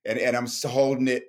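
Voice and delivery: ordinary talk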